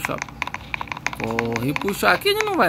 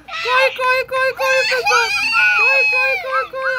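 panicked screaming; much slower than usual. Pretend. Recognising the monster, fleeing.

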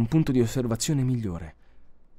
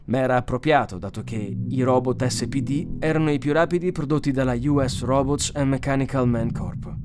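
plain reading voice

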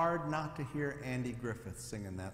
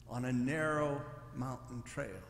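ordinary talk

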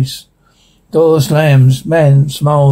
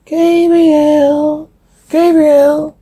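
high, held, wailing name; eerie demonic call; 11 semitones higher, much slower than usual. Acted. Spirit calling to Gabriel amid rattling and growling, eerie.

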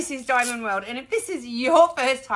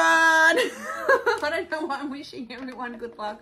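laughing at herself; 6 semitones higher than usual. Real, not acted.

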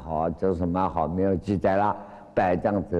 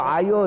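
sudden high yelp of pain, a touch louder; cry of pain; 10 semitones higher, 4 dB louder than usual. Retold or imitated. Baizhang's nose twisted by master Mazu, high pained yelp. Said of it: "等他叫".

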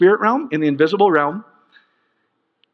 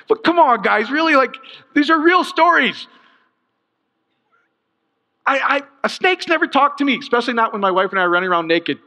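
worked up; 9 semitones higher than usual. Real, not acted. Pastor scoffing at the talking snake story, all worked up. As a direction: pitch leaping up in exasperated disbelief. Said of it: "I'll get all worked up again".

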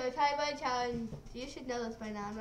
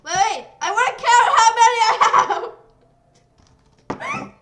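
laughing; 5 semitones higher, 14 dB louder, faster than usual. Real, not acted. Laughing excitedly mid-challenge.

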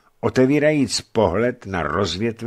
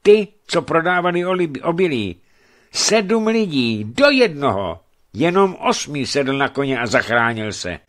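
high, loud, urgent telling; agitated report; 7 semitones higher, 6 dB louder than usual. A narrator's reading. Messenger telling merchant of the killings, agitated. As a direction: pour out the grim news in alarm.

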